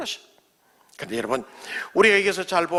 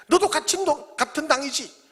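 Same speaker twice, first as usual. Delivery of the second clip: put-on accusing servant girl; 9 semitones higher than usual.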